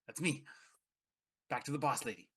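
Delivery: ordinary talk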